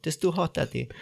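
little whiny kid impression; complaining child. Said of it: "The ice was too hot".

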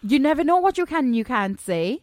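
light Welsh lilt; Welsh accent. Acted. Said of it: "Was it a Welsh accent you just went into there".

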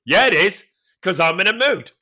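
a grumpy boast at full volume; defiant and loud. Retold or imitated. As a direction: bite back at the trainer. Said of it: "And I went".

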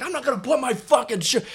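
high-pitched indignant tough guy; imitated belligerent coworker; mock defiant refusal. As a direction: refuse the order, voice way up. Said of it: "He's like".

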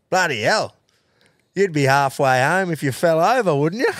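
lightly voiced old pub fella ribbing a tall stranger; old fella's cheeky jab. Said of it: "And he just goes".

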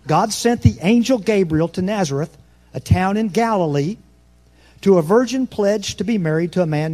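loud scripture reading. Acted. Pastor reading Luke aloud to the church, loud proclamation. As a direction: declaim the verses to the back row.

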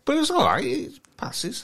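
very high falsetto consolation; high-pitched, half-hearted approval; squeaky mock reassurance. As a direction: let them down gently in falsetto. Reassures after criticising the impression.

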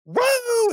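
high-pitched celebratory whoop; imitated cheer. Hockey player buzzing the bench, high imitated whoop.

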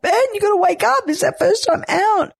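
high, fast pleading; mock urgent nudge. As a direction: jolt him awake. Waking sleepy husband at lunch.

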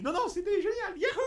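a cheerful yahoo like the plumber himself; Mario voice. Retold or imitated. Retelling the voice actor's easter egg, in a high laughing Mario voice. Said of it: "Il fait, il fait le vrai truc".

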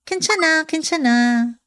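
quick high Kenchana, kenchana, waving off concern; brushing it off. Shown as a demonstration. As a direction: insist you're fine, lightly. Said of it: "when you want to say that you're fine".

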